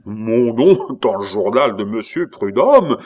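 loud, incredulous echo; outraged exclamation. A narrator's reading. Abbé hears his name would be printed, outraged exclamation. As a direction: throw the offer back as a scandalised cry. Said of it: "s'écria l'abbé".

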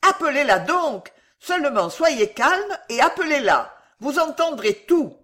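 high, insistent shouted plea; at full lung. A narrator's reading. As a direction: urge them loudly to fetch the mistress. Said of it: "continua monsieur Pickwick de toutes les forces de ses poumons".

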